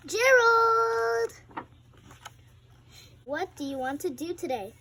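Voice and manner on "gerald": high loud call of Gerald; excited loud shout of a name. Acted. Piggy calls out to friend.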